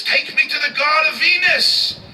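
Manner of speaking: high, loud commanding cry; high loud insistent call; loud demand. Acted. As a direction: bellow the order like an outraged ancient. Ancient Roman demanding his god.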